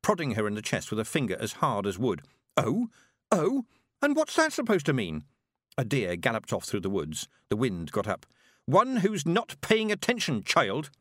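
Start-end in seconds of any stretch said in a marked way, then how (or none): 2.6-5.2 high, affronted challenge; high-pitched girl spoiling for a fight; indignant. Acted. Girl in heated quarrel. 8.6-10.9 high, slow, pointed rebuke; old witch losing patience; cross retort. Acted.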